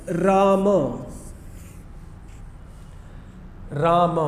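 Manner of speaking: राम said with a full final a, as Raama; pronunciation demo. Shown as a demonstration. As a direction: voice the last a in राम clearly, Raama. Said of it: "मेरा उच्चारण देखिए".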